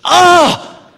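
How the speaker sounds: high, loud, gushing excitement; gushing churchgoer. Retold or imitated. Mimicking a churchgoer who gushes about revival on Sunday. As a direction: rave like a newly fired-up believer. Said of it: "Somebody will come up on a Sunday and say".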